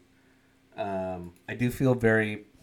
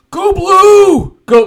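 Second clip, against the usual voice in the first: high loud fake fan cheer; mock fan cheer; 17 dB louder, much slower than usual. Mocking timid fan cheer.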